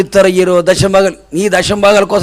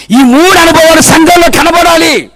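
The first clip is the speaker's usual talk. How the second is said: raised, ringing sermon delivery; full-force preaching; 9 semitones higher, 4 dB louder than usual. Real, not acted. Preacher telling the church it applies to everyone, full-force preaching. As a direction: preach it out high to the crowd.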